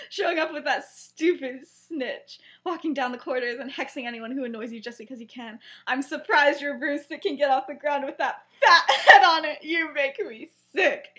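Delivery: acting out a telling-off, a laugh slipping in; retold angry tirade. Lily's acted tirade at James.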